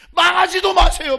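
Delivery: high, very loud preaching cry; full-force pulpit shout; shouted exhortation. Real, not acted. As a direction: thunder the warning at the congregation. Exhorts congregation, then repeats lower.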